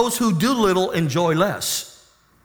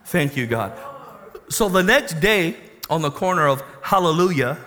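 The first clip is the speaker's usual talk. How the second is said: usual speaking voice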